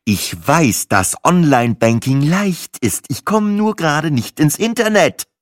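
exasperated, leaning heavily on each word; pissed off. A narrator's reading. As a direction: spell it out, annoyed and rising. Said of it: "mein Tonfall von verschnupft zu angepisst wechselte".

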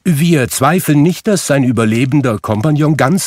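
high, loud, effusive visitor; high-pitched, officious charity caller; courteous gentleman. A narrator's reading. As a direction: flatter him with formal confidence. Gentleman flatters Scrooge for a donation.

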